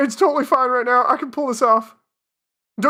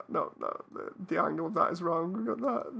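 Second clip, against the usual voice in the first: ordinary talk, nothing marked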